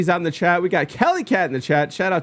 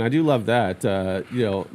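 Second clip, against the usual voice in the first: ordinary talk, nothing marked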